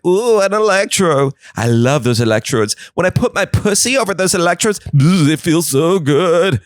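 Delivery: a cheeky high-pitched puffer fish purring over the electrodes. Retold or imitated. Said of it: "A puffer fish was like".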